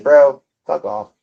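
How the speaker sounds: ordinary delivery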